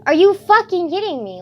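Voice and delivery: loud, high burst of disbelief; indignant outburst. Real, not acted. Ranting about choir kids making others suffer, indignant outburst. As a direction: explode in outrage on a friend's behalf. Outraged on her suffering friend's behalf.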